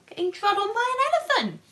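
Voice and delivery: high, fast squeal of dismay; squeaky. Real, not acted. Telling Dotty how awful it'd be, squealing. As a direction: shriek it in mock horror.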